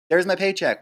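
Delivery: pleased crew member. Retold or imitated. Flight attendant checking her pay.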